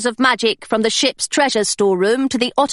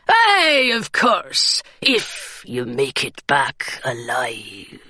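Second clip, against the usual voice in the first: pirate's ominous joke; 9 semitones lower, much slower than usual. Acted. Twiggett's ominous reply.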